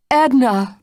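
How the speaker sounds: high, loud wail of her name. A narrator's reading. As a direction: wail it out in despair. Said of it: "Blanche wailed".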